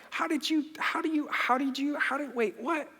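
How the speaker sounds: high stammering disbelief; flustered excuse mode. Retold or imitated. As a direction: trip over words looking for a way out. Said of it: "go into excuse mode".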